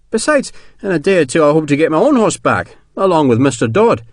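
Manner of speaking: a hint of an embarrassed colonel; lightly voiced colonel. Colonel declining sergeant's gift, lightly voiced.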